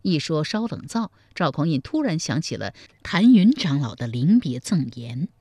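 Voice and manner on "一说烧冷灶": usual speaking voice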